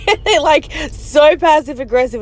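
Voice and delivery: usual speaking voice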